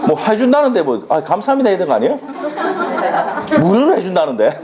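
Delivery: high-pitched, laughing protest; playful protest. Real, not acted. Teacher teasing students about free extra classes, playful protest. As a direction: chide them jokingly, pitched well up.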